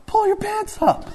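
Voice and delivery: very high, cracking into laughter; laughing punchline. Real, not acted. Preacher tells the congregation, breaking into high laughter. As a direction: let the punchline squeak up and dissolve in laughter.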